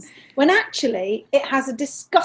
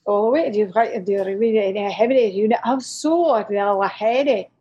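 lower, thick Scottish mum voice, nearly unintelligible; Agnes; 4 semitones lower than usual. Acted. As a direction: garble it in a heavy Scottish accent. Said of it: "This is Agnes".

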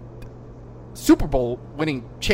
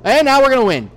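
mocking building excitement; 6 semitones higher, 6 dB louder, faster than usual. Mocks team's chaotic last minutes.

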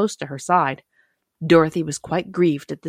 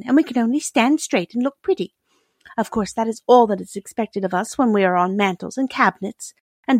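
higher-pitched princess voice; dainty china princess; 6 semitones higher than usual. A narrator's reading. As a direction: gently explain as a little china lady.

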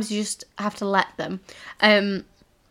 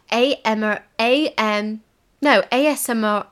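ordinary talk, nothing marked

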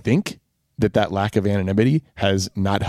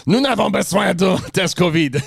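put-on higher, gruff French-accented guard; angry French; 8 semitones higher than usual. Husband imitating a supermarket guy, angry French accent. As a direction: bark it like a cross Swiss shopkeeper. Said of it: "you sound very angry when you speak in French".

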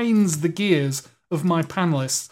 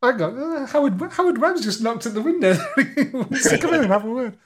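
laughing; 5 semitones higher than usual. Real, not acted. Host laughing as he jokes about the referee. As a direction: joke told through laughter.

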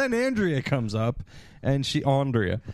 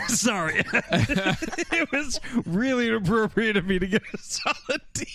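laughing apology; 6 semitones higher than usual. Real, not acted.

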